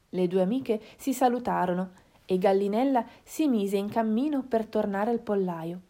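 plain reading voice

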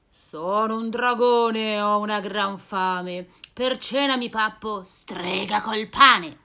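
voce più forte e cupa; drago minaccioso. Acted. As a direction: ruggisci da drago affamato. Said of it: "sente un ruggito". (il drago minaccia la strega, più forte)

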